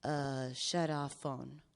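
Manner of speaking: low, slow mutter, mimicking dad; a lightly voiced dad muttering to himself. Imitating dad muttering to himself as he shuts off the phone. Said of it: "And then to himself, I heard him go".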